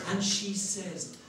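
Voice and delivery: usual speaking voice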